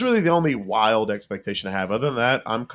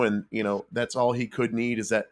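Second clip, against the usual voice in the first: ordinary talk, nothing marked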